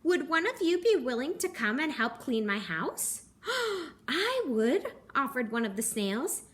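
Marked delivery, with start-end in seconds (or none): none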